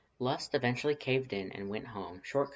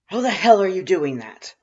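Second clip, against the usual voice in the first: much higher and louder baffled yell; shouting; 10 semitones higher, 16 dB louder than usual. A narrator's reading. Confused caller yelling at the phone, loud baffled shout. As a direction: holler in disbelief.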